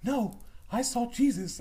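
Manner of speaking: put-on slow, high child voice, insisting; adamant little child. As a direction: imitate a child standing firm. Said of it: "she was so adamant about it".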